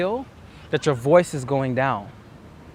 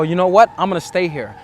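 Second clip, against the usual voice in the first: resolve; 2 semitones higher, 7 dB louder than usual. Retold or imitated. Retelling his resolve to stay.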